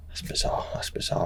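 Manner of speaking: hushed, breathy under the breath; stunned whisper to himself; whispered disbelief. Retold or imitated. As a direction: murmur it to yourself in shock. Stunned inner thought, whispered.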